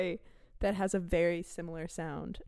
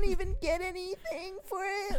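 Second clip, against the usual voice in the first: whiny, raised bro voice; a whiny, pitched-up guest complaining; annoying character voice; 9 semitones higher than usual. Acted. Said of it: "he got really annoying really fast".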